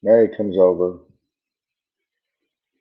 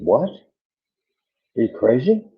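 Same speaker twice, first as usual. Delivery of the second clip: incredulous; 6 semitones higher, much slower than usual. Retold or imitated. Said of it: "She said".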